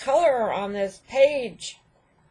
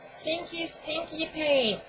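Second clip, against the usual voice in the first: high, slow disgusted singsong; whiny disgust at the smell; 8 semitones higher, 6 dB quieter than usual. Real, not acted.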